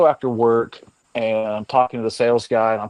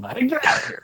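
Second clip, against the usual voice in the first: mock scream. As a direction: re-enact screaming at a mouse in a high, held-back voice. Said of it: "when I was screaming".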